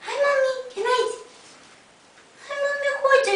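high, soft coaxing call; calling puppies sweetly. Real, not acted. Owner softly calling her puppies over, high and sweet.

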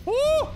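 loud, high-pitched "ooh"; high startled whoop. Real, not acted. Player reacting to elephant's attack, startled high whoop. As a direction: let out a sudden high whoop.

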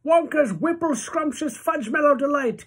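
put-on high, loud, excited old-man cry; cried out. A narrator's reading. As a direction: call out the name with glee. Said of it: "cried Grandpa George".

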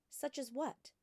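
put-on a bright young girl asking her teacher; young Matilda. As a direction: ask like a curious small girl. Matilda's childlike voice.

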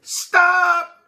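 high, loud call of stop; old man yelling stop as he chases. Acted. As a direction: yell after the runaway cookie. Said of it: "yelled the old man".

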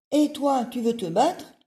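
loud, high, cocky challenge; ladybug voice. Acted. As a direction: pick a fight, chest out.

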